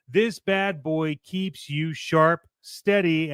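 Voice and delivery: ordinary talk, nothing marked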